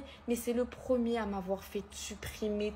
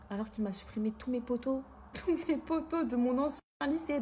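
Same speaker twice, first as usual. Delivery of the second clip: normal talking voice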